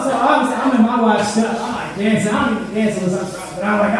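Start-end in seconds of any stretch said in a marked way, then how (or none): none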